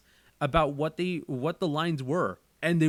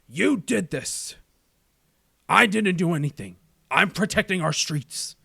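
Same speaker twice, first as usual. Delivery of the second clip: louder, indignant crime-boss accusation; Kingpin; 3 semitones higher, 5 dB louder than usual. Acted. Crime boss blames his rival and defends himself, forceful anger. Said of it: "say it in my Kingpin voice".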